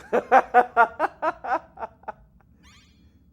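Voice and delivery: long, high, loud laughing fit. Real, not acted. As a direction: laugh helplessly at the teasing. Laughs at friend's teasing.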